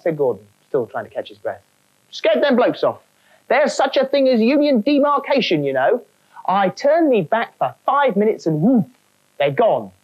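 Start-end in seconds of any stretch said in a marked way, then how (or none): 2.1-9.9 higher cockney grumbling spook. Acted. Trainee ghost complaining to the gopher, cockney. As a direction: grumble like a put-out stagehand.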